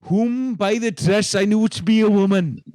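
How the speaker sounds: put-on resume the statement in a heightened Welsh accent; funny Welsh accent. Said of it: "in a funny accent".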